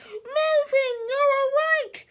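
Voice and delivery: very high, loud happy cry; overjoyed mother. Acted. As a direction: greet your son with relief. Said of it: "cried Miss Sneedley".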